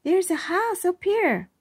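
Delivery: put-on high, excited child's voice; excited little girl. A narrator's reading. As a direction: share the discovery eagerly. Iris calls from tree.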